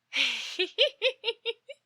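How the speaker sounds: a sudden high giggle, pleased with himself; quick unhinged titter; high creepy giggle. Acted. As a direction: giggle like someone savoring a secret. Stalker gloats about secret following.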